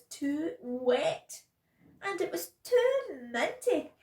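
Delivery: loud, high, drawn-out grumbles; exaggerated complaining. A narrator's reading. Bella at tea and bath, exaggerated complaining. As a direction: hammer each complaint, stretching the last word.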